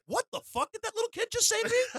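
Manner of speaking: high-pitched mock disbelief, laughing; stunned reaction. Imitating everybody hearing Mike Tyson, stunned disbelief. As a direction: squeal it like an outraged, baffled onlooker. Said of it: "everybody was like".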